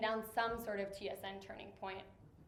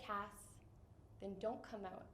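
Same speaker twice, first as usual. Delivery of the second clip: gentle retold advice; 2 semitones lower, 14 dB quieter than usual. Friend advises her about coming out, gently and quietly. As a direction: say it softly, leaving room between phrases.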